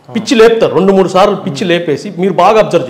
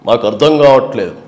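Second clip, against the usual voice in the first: Chandrababu press meet; 6 semitones lower than usual. Retold or imitated. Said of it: "press మీట్లో మాట్లాడినప్పుడు slow మాట్లాడతారు".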